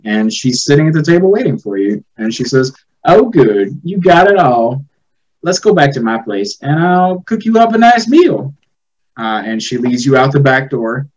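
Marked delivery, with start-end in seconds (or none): 3.0-8.5 sweet hostess voice. Acted. GM voices Gertrude.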